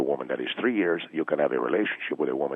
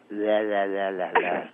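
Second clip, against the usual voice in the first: rolling the tongue on la la la, a romantic trill; 3 semitones higher, 3 dB quieter, slower than usual. Shown as a demonstration. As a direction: purr a seductive tongue trill. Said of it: "I will go".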